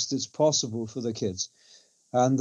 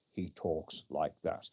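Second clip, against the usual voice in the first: much lower, much softer and slow mock-serious voice; Wakefield's serious tone; 10 semitones lower, 9 dB quieter, slower than usual. Mocking Wakefield's serious voice to a fellow activist, low, soft and slow. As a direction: drop into a grave, hushed imitation, slowly. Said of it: "he only ever talks in two tones".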